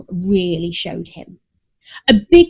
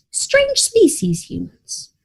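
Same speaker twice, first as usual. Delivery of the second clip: wry aside; 6 semitones higher, slower than usual. A narrator's reading.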